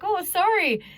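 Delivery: mock-apologetic, jumping up high; Canadian apology. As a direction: re-enact a reflexive Canadian apology, pitched high. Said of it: "stood there like a Canadian and apologized".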